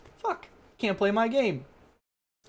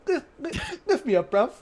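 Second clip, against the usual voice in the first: exaggerated mock British accent; shitty British accent; 9 semitones higher than usual. Trolling stream chat with a bad British accent. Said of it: "The worst British accents".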